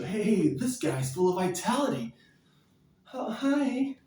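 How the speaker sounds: lightly voiced admiring girl. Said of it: "You want the chicks to look at you".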